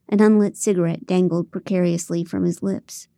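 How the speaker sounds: ordinary delivery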